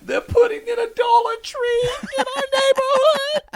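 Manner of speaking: high ecstatic mock shriek; overjoyed shriek. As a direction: squeal with joy at the news. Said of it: "She went".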